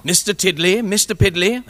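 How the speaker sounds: ordinary talk, nothing marked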